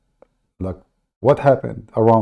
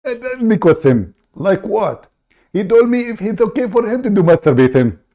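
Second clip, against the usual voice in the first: raised, pushy put-on caller voice; fake caller voice; 8 semitones higher than usual. Prank caller cutting into the host's show, high put-on voice. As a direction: barge in as an eager fake caller.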